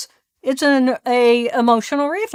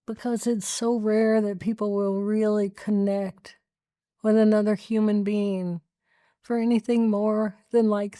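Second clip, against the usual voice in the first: much softer, tender and heartfelt; soft sincere; 3 semitones lower, 6 dB quieter than usual. Real, not acted. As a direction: speak gently, from the heart. Heartfelt confession after emotional moment.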